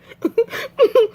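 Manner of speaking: squealing high laugh; high-pitched giggle. Real, not acted. Laugh alone.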